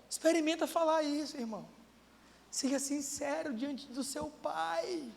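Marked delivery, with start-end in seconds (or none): none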